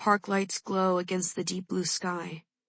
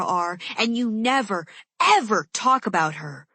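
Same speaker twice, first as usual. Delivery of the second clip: loud, higher, relentless accusation; angry tirade; 4 semitones higher, 6 dB louder than usual. A narrator's reading. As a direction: pile it on, one charge after another. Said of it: "my entire tirade".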